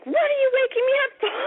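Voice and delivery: high, fast mock-angry complaint; mad mother. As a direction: imitate an irritated mom just woken. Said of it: "she was really mad".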